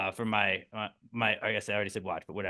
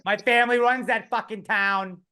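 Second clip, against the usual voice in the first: higher, cocky New York Italian; mock wiseguy boast; 6 semitones higher than usual. Italian mobster brags to Batman, mock cocky tone. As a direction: brag about running the town.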